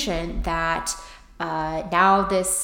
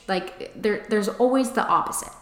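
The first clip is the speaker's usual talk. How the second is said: normal talking voice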